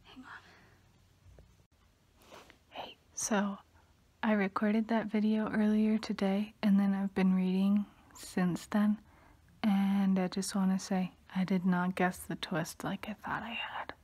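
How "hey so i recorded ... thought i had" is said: whispering. Real, not acted. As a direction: whisper so no one wakes. Whispered late-night update.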